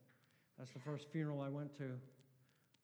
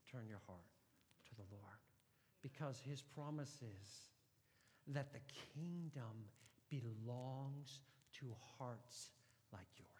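tender appeal; slower than usual. Acted. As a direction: draw them in gently. Soft tender appeal to listeners.

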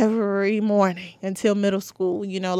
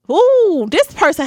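loud high ooh before the rant; real fired-up ooh, much higher and louder; heated ooh; 10 semitones higher, 8 dB louder than usual. Real, not acted. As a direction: let out an ooh as the anger comes back. Heated memory of hurtful ex.

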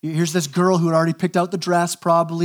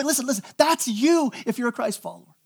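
normal talking voice

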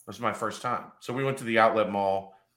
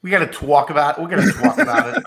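put-on New York accent; 9 semitones higher, 9 dB louder than usual. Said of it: "are you from New York all of a sudden".

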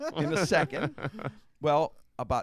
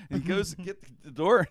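normal talking voice

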